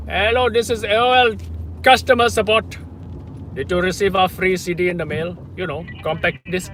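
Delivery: put-on loud, high Indian-accented support caller; scam caller Indian accent. Pranking as AOL customer support, a loud high Indian-accent caller. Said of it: "she's talking about the Indian accent".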